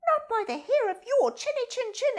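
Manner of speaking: high, loud, snippy chick voice; rude chick. Acted. Chick refusing the fox entry, high and rude. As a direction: slam the door in his face.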